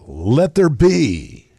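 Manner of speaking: slow, loud, grand; booming voice of God. Pretend. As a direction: thunder it out like God speaking. Quoting God's command dramatically.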